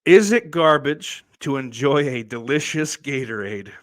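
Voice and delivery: mildly mock-earnest question read; lightly voiced fan asking a silly question. Reading out a fan's email question, mild mock earnest. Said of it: "so the question was".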